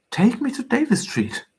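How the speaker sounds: high-pitched imperious order; commanding. A narrator's reading. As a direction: order it like a lady giving commands. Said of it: "she commanded".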